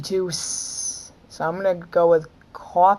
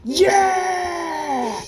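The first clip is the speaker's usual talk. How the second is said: triumphant shout; 12 semitones higher than usual. Real, not acted. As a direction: cheer the win. Game win cheer.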